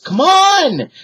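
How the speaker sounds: high, loud urgent call; Annie shouting. A narrator's reading. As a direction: yell at him to hurry up. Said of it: "Annie shouted".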